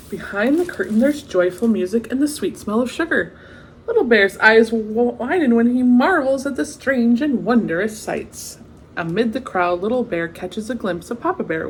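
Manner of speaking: lively storybook read-aloud; story narration. A narrator's reading. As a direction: read it like a bedtime picture book. Reading the picture book aloud.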